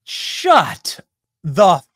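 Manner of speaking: exasperated outburst. Real, not acted. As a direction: blurt it out at the screen. Outburst at the moderator on screen.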